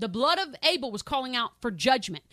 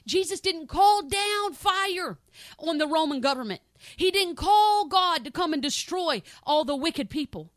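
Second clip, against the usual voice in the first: preaching emphasis; 5 semitones higher, 6 dB louder than usual. Real, not acted. Preaching builds.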